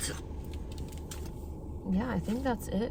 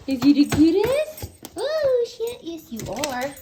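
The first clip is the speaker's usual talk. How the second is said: talking to a dog; 10 semitones higher, 5 dB louder than usual. Real, not acted. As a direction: squeal with delight at the puppy. Repeated cooing at puppy.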